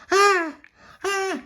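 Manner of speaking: very high short squeals; baby's cry. A narrator's reading. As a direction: squeal like a startled baby. Baby sees something.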